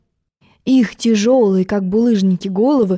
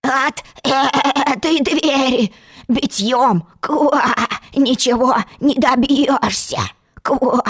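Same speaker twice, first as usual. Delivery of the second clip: slow hoarse frog voice, higher; croaking frog; 3 semitones higher, slower than usual. Acted. Frog explaining the door to Alice, hoarse slow croak. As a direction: rasp and stretch it like a frog. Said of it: "лягушатище".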